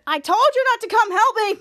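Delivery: high, loud exasperated telling-off; mock angry scold. [name] scolds the team for coming to help, high and loud. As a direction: snap it at the friend who came anyway.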